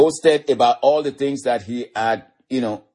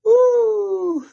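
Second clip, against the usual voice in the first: very high, long "ooh" after a laugh; ecstatic whoop; 4 dB louder than usual. Real, not acted. Preacher overcome by God's mercy, high whoop.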